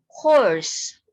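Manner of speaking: horse said clearly and loud for repeating. Shown as a demonstration. Teacher models horse for pupils to repeat, loud and clear. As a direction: call out horse for the class to echo. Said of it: "Listen and repeat after your teacher".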